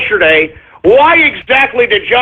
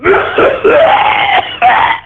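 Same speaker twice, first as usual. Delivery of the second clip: choking cough. Real, not acted. Host chokes on his scotch mid-rant, coughing hard. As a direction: hack it out as the whiskey goes down wrong.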